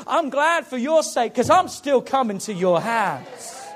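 fiery pulpit sermon climax; full-force preaching. Real, not acted. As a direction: build the congregation up and push hard.

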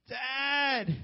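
loud high call to dad from bed; child shouting for his father; kid calling out. Retold or imitated. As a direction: yell for dad from the bedroom.

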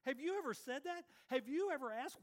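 usual speaking voice

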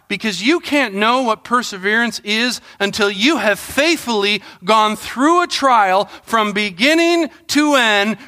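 ordinary talk

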